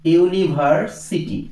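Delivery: University drawn out slowly, syllable by syllable; slow teacher demo. Shown as a demonstration. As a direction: stretch university out for students to hear the opening 'yu'. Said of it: "আবার দেখো".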